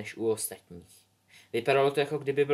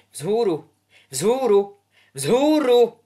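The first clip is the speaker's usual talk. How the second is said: building from loud to loud and high; desperate repeated command; 10 semitones higher, 10 dB louder, much slower than usual. Acted. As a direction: plead ever harder with the broom. Albus failing, building desperation.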